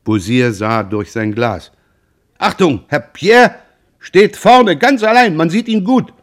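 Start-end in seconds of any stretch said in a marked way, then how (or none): 2.4-6.1 very high, loud eager call; excited spotting. A narrator's reading. Beausire at the spyglass pointing out Pierre, high excited call.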